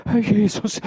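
high, loud cry of dread; terrified cry. A narrator's reading. Maid before the drawn dagger, terrified cry. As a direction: cry out expecting the death blow. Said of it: "rief sie, den Todesstoß erwartend".

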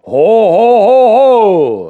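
loud, high drawn-out jeering ho; crowd jeering. A narrator's reading. The workers jeering at the dike reeve. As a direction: roar it like a hostile crowd. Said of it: "erscholl es da".

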